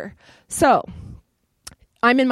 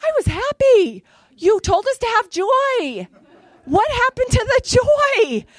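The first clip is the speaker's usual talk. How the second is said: high, louder, slow wailing complaint; mock-aggrieved protest to heaven; complaining to God; 13 semitones higher, 5 dB louder, slower than usual. Mad at God after the accident, high, slow wail. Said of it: "I was kinda mad at God".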